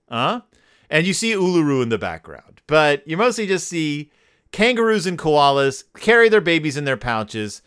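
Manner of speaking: ordinary talk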